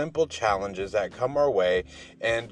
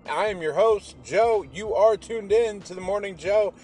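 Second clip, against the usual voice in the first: pitched higher and louder, a lively host; upbeat show intro; 9 semitones higher, 5 dB louder than usual. Acted. Host welcoming his podcast family with a higher, louder lively intro. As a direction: pump up the listeners with a bright intro.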